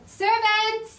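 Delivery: loud high call for the servant; summoning a servant at full voice. Acted. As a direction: shout across the hall for help. Calling out for a servant.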